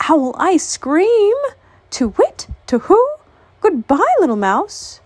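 high, startled owl hurrying off; scared owl. Acted. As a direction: squeak it out in alarm and hurry away. Frightened owl flees.

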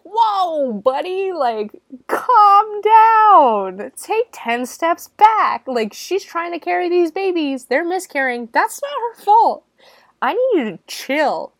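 loud, high-pitched telling-off, words stretched out; outraged shouting. Acted. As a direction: yell at him to back off, drawing out the big words. Reacts outraged at the husband.

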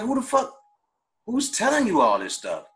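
high-pitched retold disbelief; indignant retort. Man retelling his reply to people calling him mad, indignant.

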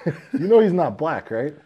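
high, loud laughing question; laughing disbelief. Retold or imitated. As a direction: laugh it out, incredulous.

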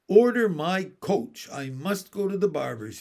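grand royal command, high and loud at first; lordly, starting high then settling; magnificent. A narrator's reading. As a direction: announce it like a king. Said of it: "he said magnificently".

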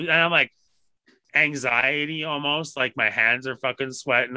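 ordinary delivery

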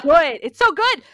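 loud, high-pitched gushing; excited outburst. Real, not acted. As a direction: shout the praise, overcome with enthusiasm. Raving about the film amid laughter.

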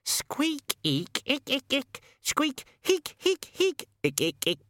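rapid string of tiny high squeaks and icks; very high rodent chatter; chattering rat squeaks. A narrator's reading. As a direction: squeak urgently like a rat telling a story.

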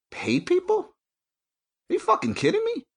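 shrill disbelief. Real, not acted. Outraged disbelief at store.

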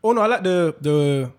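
ordinary talk, nothing marked

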